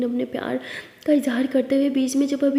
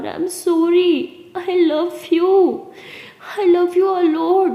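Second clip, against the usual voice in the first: drawn-out, higher, louder I love you; very slow pleading; 5 semitones higher, 4 dB louder, much slower than usual. Acted. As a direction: stretch each word as you beg. Begging lover apologising. (बहुत धीरे, खींचकर मनाते हुए)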